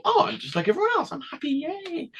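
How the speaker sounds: put-on high, fast, chirpy little-kid voice; a hammed-up happy kid, squeaky and quick; mock cheerful child. Mocking his younger self as a cheery, oblivious kid.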